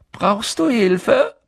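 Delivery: high, loud, drawn-out offer; calling out. A narrator's reading. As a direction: call it over to someone struggling.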